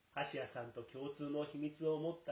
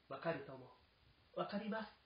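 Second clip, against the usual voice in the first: put-on eager boy; 6 semitones higher than usual. A narrator's reading. Boy Jiro answers eagerly.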